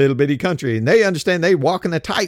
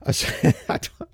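can't get the word out for laughing; real laughter cutting off the reading; breaking into laughter; 5 semitones lower, 4 dB quieter than usual. Real, not acted. Host reading the news laughs at the troop count, laughing. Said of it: "I can't read this next sentence without laughing".

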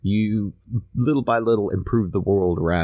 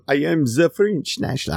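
halting, higher-pitched try at French English; badly attempted French accent; 7 semitones higher than usual. Playing a French nationalist answering a traveler, mock French accent. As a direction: have a feeble go at a French accent. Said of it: "I can't do a French accent".

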